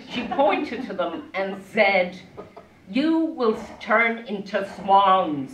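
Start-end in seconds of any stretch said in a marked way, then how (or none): 2.9-5.5 slow, louder, higher-pitched pronouncement; casting a spell. Acted. Stepmother curses the children on the lake, slow spell-casting voice. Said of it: "She cast a spell on the children".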